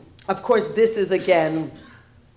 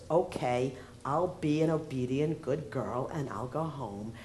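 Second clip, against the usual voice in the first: softening and fading to a meek murmur; meek obedient girl; 5 semitones lower, 7 dB quieter than usual. Retold or imitated. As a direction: play the dutiful good girl, voice sinking. Obedient daughter-in-law voiced.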